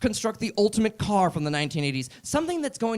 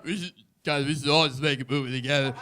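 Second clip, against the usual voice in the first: Stallone voice; 2 semitones lower than usual. Retold or imitated. Stallone voiced proposing movie.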